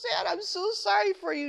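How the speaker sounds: high, syrupy mock pity; mock pitying sympathizer. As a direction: gush sympathy like a pitying friend. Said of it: "somebody who says".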